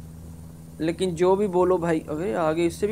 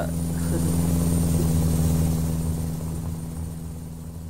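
1 laugh; 13 semitones lower, 4 dB quieter than usual. Real, not acted. Enjoying the view.

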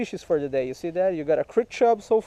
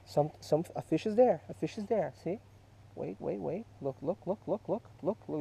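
usual speaking voice

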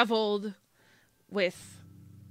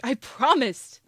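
choking up; 5 semitones higher, 9 dB louder than usual. Acted. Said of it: "start to, like, choke up a bit".

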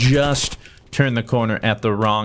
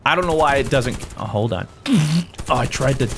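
ordinary talk, nothing marked

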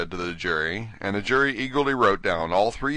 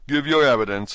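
king voice; 6 semitones higher, 7 dB louder than usual. Acted. King orders the witness.

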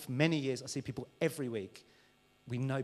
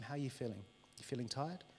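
gentle caring; 3 semitones lower, 10 dB quieter than usual. Acted. God's gentle question.

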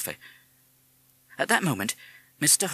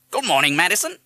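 high, loud parrot echo; parrot mimic; 9 semitones higher, 9 dB louder than usual. A narrator's reading. Parrot replying to the mother, loud mimicry. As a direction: parrot it back loudly.

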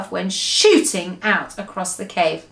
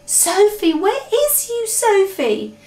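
high-pitched calling; anxious searching call; 11 semitones higher, slower than usual. A narrator's reading. BFG looks for hidden Sophie, calling.

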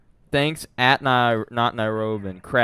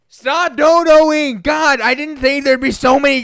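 drawn-out, higher, louder yell; real exasperated shout; 11 semitones higher, 6 dB louder than usual. Real, not acted. Streamer begging chat to stop donating, drawn-out high yell. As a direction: yell it slow in mock despair.

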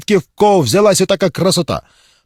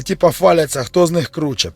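usual speaking voice

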